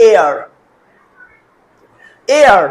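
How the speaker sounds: এয়ার said twice with a long pause, no "h" at the start; silent H. Shown as a demonstration. Teacher demonstrates totally silent H, এয়ার begun on a vowel. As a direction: open এয়ার straight on the vowel. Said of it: "এইচ লেটারটার sound একদম totally silent".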